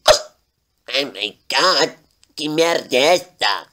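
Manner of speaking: voz de personagem esticada e lenta, assustado; susto do Lorenzo. Acted. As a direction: solta devagar, espantado com o barulho. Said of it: "E ele falou assim". (Lorenzo assustado com o barulho, fala arrastada)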